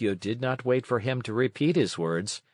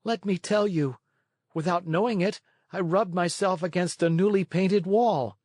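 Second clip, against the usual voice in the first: higher puppet voice, telling a fib; a boy caught out, bluffing; lying puppet boy; 8 semitones higher than usual. A narrator's reading. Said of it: "he lied".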